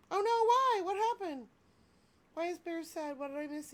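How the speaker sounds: high, gushing squeal over a cute toy. Real, not acted. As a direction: coo like it's a tiny pet. Cooing over plush toys.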